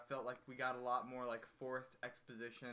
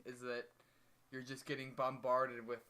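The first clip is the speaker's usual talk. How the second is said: ordinary talk, nothing marked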